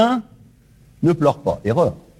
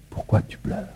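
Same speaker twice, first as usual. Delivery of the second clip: tender whisper; whispered question; 10 dB quieter than usual. Acted. Preacher passing on Jesus's question, whispered. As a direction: breathe it softly to each listener. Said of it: "il m'a chargé de vous dire".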